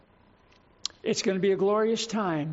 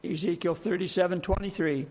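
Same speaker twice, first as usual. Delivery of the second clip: usual speaking voice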